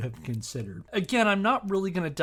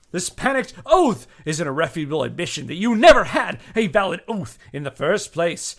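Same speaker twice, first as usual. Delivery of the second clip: louder, declaiming each charge like a prosecutor; dramatic letter reading; 4 semitones higher, 8 dB louder than usual. Acted. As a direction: read it out as a righteous indictment. Reads the accuser's letter aloud.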